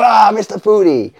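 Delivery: high, loud mock exclamation; startled cry. Teller as soldier crept up on at night, startled cry. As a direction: cry out as if jumped from behind. Startled Shabani greets him.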